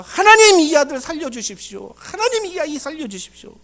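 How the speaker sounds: high, urgent plea that softens as it goes; earnest pleading. Acted. Elijah begs God to revive the boy, earnest pleading. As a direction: implore God, crying out on each call. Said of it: "간절히 목소리 내서 기도했다".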